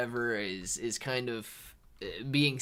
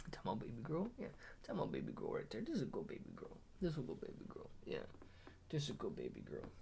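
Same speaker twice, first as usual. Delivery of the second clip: soft cooing; 3 semitones lower, 16 dB quieter, faster than usual. Real, not acted. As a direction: murmur sweet praise, barely above a whisper. Cooing to baby girl mid-stream.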